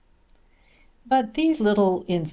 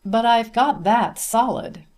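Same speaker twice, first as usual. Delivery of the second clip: proud, louder and higher; with feeling; 5 semitones higher, 5 dB louder than usual. A narrator's reading. Student boasting of his one phrase, proudly. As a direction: declare it with pride. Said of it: "then added with feeling".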